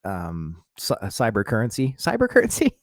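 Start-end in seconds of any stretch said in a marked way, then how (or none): 2.0-2.8 word breaks into a high laugh; self-mocking laugh on the word. Real, not acted. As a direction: laugh at your own clumsy word. Repeats word, laughing at himself.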